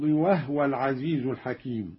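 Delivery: وهو recited "wahwa", h without a vowel; sukun on ha. Shown as a demonstration. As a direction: drop the vowel after the h in وهو. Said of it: "بإسكان الهاء هكذا".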